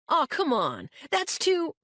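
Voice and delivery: high, rising protest. Acted. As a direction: plead against it. Josh protests, cut off.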